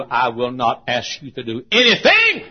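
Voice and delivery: loud, emphatic proclamation; God declaring. Acted. God promising the believer, loudly declaring. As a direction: build to a big punch on the last word. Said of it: "God says to you".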